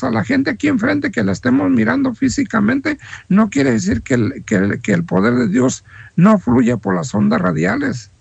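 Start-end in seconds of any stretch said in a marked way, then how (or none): none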